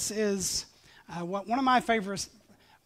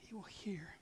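whisper; 14 dB quieter than usual. Acted. Whispered promise.

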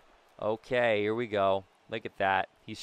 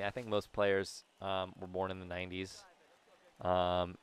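ordinary talk

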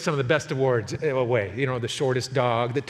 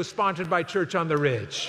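ordinary talk

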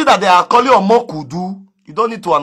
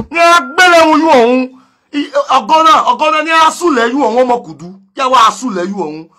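higher, loud, fast priestly curse in Yoruba; hammed-up juju priest curse; mock incantation; 5 semitones higher, 4 dB louder than usual. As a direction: rattle it off like a native priest's spell.

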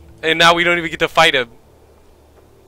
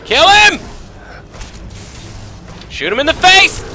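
urgent shout; 12 semitones higher than usual. Real, not acted. Gamer ordering the bear shot in the face, urgent shout. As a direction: yell the attack order, pitched way up. Calling out during game fight.

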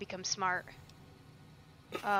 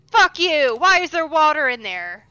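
loud, high angry yell; frustrated yell; 6 semitones higher, 13 dB louder than usual. Real, not acted. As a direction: snap at the screen. Frustrated at game.

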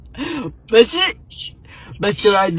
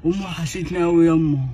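mock grief; 6 dB quieter than usual.

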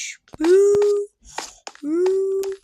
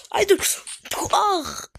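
ordinary talk

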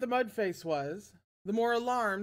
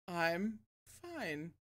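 normal talking voice

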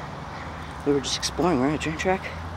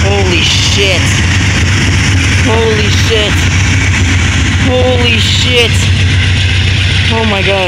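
stunned, louder each time; shocked exclamation; 2 semitones higher, 5 dB louder, slower than usual. Real, not acted. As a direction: gasp it out in disbelief. Train passes very close.

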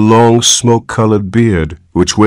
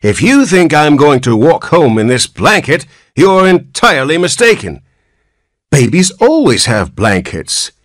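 put-on cranky old man; 9 semitones higher, 3 dB louder than usual. Old man voiced in story.

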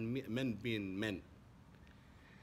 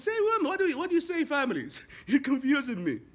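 much higher and louder, laughing at the end; exasperated outburst; 12 semitones higher, 9 dB louder than usual. Retold or imitated. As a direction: blow up in disbelief. Heated argument about families.

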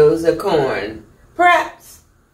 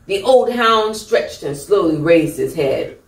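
ordinary delivery

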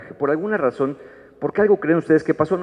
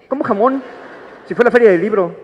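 much higher and louder outraged squeal; can't believe they forgot; indignant disbelief; 9 semitones higher, 4 dB louder than usual. Acted. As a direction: spouse outraged the book fair was forgotten. Spouse indignant about forgotten fair.

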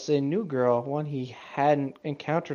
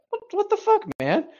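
shocked, much higher pitch; indignant squeal. Real, not acted. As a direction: squeak out in mock outrage. Reacts indignantly to author line.

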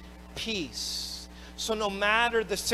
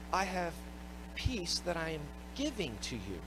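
much softer, gentle and steady; calm reassurance; 3 semitones lower, 11 dB quieter than usual. Acted. Jesus quietly reassuring his frightened disciples, soft and calm. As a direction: hush the panic with quiet comfort.

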